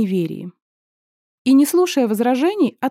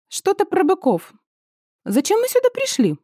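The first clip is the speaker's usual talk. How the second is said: alarmed; 9 semitones higher than usual. Acted. Startled heroine, pitch jumps.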